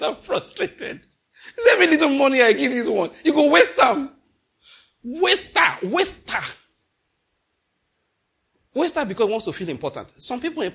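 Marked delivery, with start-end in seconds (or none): 0.0-4.1 loud, high laughing mock complaint; laughing mockery. Preacher mocking a wasteful spouse, loud and laughing. 5.0-6.4 mock insult. Name-calling the wasteful.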